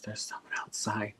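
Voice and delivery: breathy retold whisper. Niece whispering a warning to her uncle. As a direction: whisper it close in his ear. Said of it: "She whispers in my ear".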